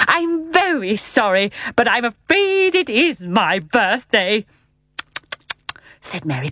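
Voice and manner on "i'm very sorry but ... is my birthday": slow, high-pitched, drawn-out apology; apologetic. A narrator's reading. Uncle owning up to Mary Poppins, apologetic. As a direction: confess it as a sheepish old uncle. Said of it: "looking apologetically at Mary Poppins".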